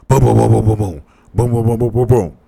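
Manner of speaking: rapid-fire vocal booms; imitated door banging. Storyteller imitating banging on the door at night, rapid booms. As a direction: mimic a fist pounding on a door. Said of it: "She banging on the door".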